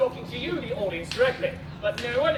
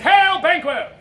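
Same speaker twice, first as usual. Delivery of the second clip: high, loud stage greeting; loud hailing; 4 semitones higher, 9 dB louder, slower than usual. Acted. Messenger hails Banquo, loud call.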